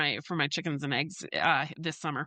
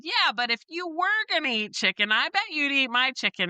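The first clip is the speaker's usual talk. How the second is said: laughing tease; 6 semitones higher than usual. Retold or imitated. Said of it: "I started to laugh and I said".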